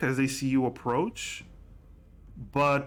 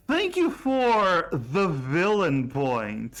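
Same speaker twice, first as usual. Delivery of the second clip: gleeful thanks; 3 semitones higher, slower than usual. Acted. GM thanks villain point player.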